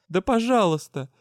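loud tearful snap on пожалуйста; tearful retort. Acted. Heroine answers the magister through tears, loud retort. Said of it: "Чувствуя, как по щекам потекли слёзы".

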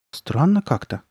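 ordinary delivery

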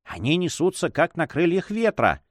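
usual speaking voice

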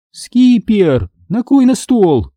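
high slow call after him. A narrator's reading. Mom calls after son to set the table, high call. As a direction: call "накрой на стол" across the house. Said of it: "крикнула мама мне вслед".

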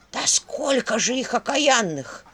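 slow, amazed exclamation; astonished. A narrator's reading. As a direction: marvel at the sight. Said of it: "изумлённо произнесла Черепуша".

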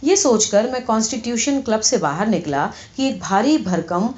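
ordinary delivery

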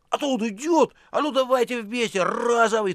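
high, loud theatrical excitement; mock-eager yell. A narrator's reading. Precentor pretending to help catch the criminal, loud and high.

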